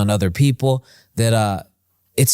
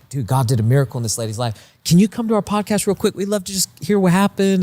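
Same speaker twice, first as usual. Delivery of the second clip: eager invitation; 7 semitones higher than usual. Retold or imitated. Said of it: "it's like".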